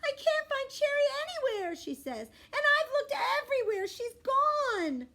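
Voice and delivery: put-on high, loud upset little-girl voice; panicked sister near tears; upset crying. A narrator's reading. Violet to Rascal about lost doll, high teary voice. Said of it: "bursts into the bathroom crying".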